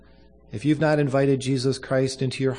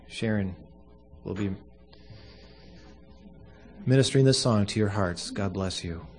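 usual speaking voice

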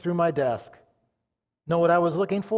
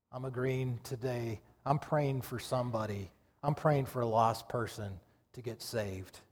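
soft, lowered preacher's voice; altar call; 5 semitones lower, 9 dB quieter than usual. Real, not acted. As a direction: gently invite the congregation, hushed and low. Preacher's hushed altar call to congregation.

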